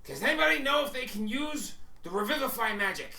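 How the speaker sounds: high, loud, urgent appeal; Max. Acted. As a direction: call out to the group for help. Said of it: "he goes".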